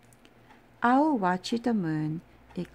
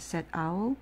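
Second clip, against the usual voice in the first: plain reading voice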